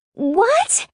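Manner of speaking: very high, piercing rise of shock. A narrator's reading. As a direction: let the shriek soar right up. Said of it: "she shrieks louder this time".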